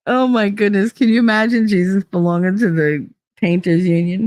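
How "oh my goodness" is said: ordinary talk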